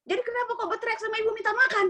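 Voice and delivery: high, fast indignant scolding; indignant rebuke. Retold or imitated. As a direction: scold the maid sharply, pitch way up. Angry rebuke of the maid. (menegur pembantu dengan nada tinggi dan cepat)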